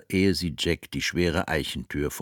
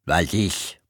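deep-voiced nurse. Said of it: "fragte die Frau mit tiefer Stimme".

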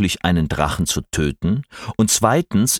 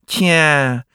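slushy lisp; 7 semitones higher, 3 dB louder than usual. A narrator's reading. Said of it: "lispelte die Schlange mit ihrer gespaltenen Zunge".

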